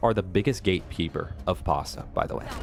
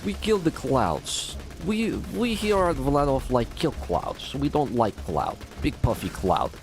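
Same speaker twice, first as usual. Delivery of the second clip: put-on Russian accent; 2 semitones higher than usual. Streamer ranting against clouds as Vladov, in a Russian accent. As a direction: rant like a Russian henchman in a thick accent. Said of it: "fine to do an accent?".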